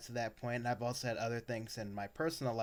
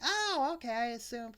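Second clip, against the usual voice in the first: much higher, airy mocking voice; mock clueless teacher; 12 semitones higher than usual. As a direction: play her as dim and dismissive.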